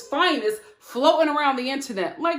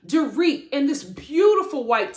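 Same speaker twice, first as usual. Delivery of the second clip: normal talking voice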